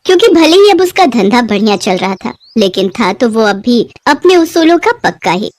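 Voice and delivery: usual speaking voice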